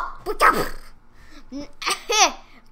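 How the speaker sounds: loud burst of laughter. Real, not acted. Laughing after the death.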